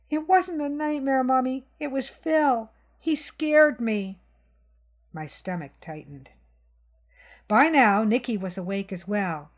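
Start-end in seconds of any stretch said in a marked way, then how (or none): 0.1-4.2 put-on high, small frightened child voice; the last words slowed and shaky with fear; scared little boy. Boy tells his mother that Phil scared him, high frightened child.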